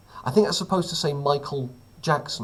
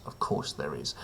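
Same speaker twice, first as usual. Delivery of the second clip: "Of course there is" dropped much lower and much softer, flat and weary; deadpan mutter; 11 semitones lower, 8 dB quieter than usual. Acted. Host to viewers, repeating it as a much lower, softer deadpan mutter. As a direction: mutter it under your breath, utterly unsurprised. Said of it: "of course there's a picture of him topless".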